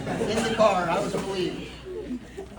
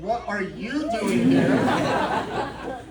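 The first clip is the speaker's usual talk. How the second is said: slow, loud, drawn-out mock outrage; over-the-top indignant comeback; mock indignant echo; 6 dB louder, much slower than usual. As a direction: stretch it out and throw it right back at him. Throws the question back.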